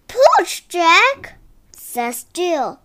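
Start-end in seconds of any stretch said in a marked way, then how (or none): 0.1-1.4 urging. Acted. Urging in story.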